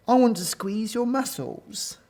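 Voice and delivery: high, eager gushing; admiring. A narrator's reading. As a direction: coo over the hero. Gorillas swoon over hero Gordon.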